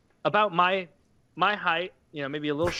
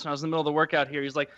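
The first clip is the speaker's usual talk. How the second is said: ordinary delivery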